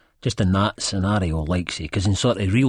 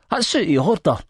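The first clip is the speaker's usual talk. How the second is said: louder, high-pitched objection; indignant protest; 10 semitones higher, 5 dB louder than usual. A narrator's reading. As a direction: call it out firmly. Narrator's character objecting to assault.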